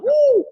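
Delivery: very high, loud "woo"; excited whoop. Retold or imitated. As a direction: whoop like an amazed onlooker. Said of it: "We was like".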